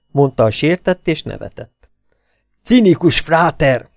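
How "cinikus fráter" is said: higher, slow indignant outburst; mildly raging, drawing out the insult. A narrator's reading. Elin insults Bobby after he laughs, fuming. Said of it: "dühöngött Elin".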